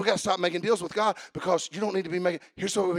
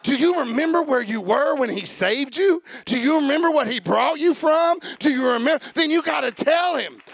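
loud, higher chanted refrain, slowing at the end; full-force preaching; 9 semitones higher, 6 dB louder than usual. Acted. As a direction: drive the refrain home, half singing.

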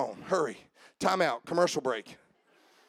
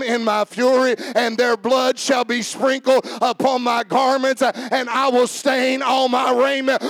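driving, raised preacher cadence; full-force preaching; 6 semitones higher, 6 dB louder than usual. Real, not acted. Preacher telling of the disciples falling off, full-force preaching. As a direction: declaim the prophecy with rising force.

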